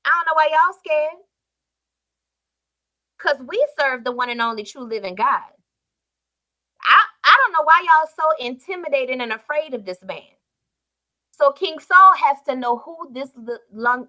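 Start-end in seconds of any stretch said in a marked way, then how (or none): 0.0-10.3 David scoffing at scared soldiers; bold David paraphrase. Retold or imitated. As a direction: say it like a brash young shepherd.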